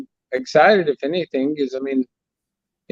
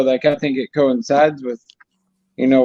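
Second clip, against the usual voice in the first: ordinary talk, nothing marked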